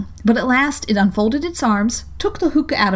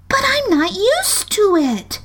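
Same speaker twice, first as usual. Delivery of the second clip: piteous; 12 semitones higher, slower than usual. A narrator's reading. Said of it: "pleaded poor Alice in a piteous tone".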